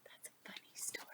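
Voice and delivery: soft breathy whisper aside. Real, not acted. Child whispering a comment during the story. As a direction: whisper it so the reader barely hears.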